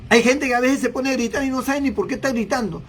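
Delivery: normal talking voice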